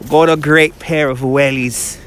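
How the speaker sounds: slow mock British accent; put-on British accent. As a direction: draw it out in a playful British accent. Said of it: "My British accent has definitely improved".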